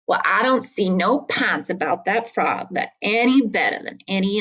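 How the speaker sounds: reading a tall tale aloud to the class; story narration. A narrator's reading. Teacher reading Twain to students, story narration. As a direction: tell the old story with a folksy lilt.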